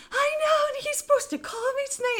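very high, fast mock wail; panicked wail. Telling friends about tonight's phone call, panicked. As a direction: whine it rapidly in panic.

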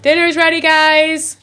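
high, loud call to dinner; yelling across the room. Real, not acted. Calling family to dinner.

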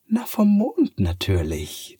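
a cheeky cat that knows better. Acted. Cat answers Sophia. (hohe, neckende Katzenstimme)

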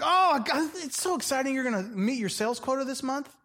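high, gushing mock excitement; high fake-thrilled boss cooing over quotas. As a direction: squeal like an oblivious boss.

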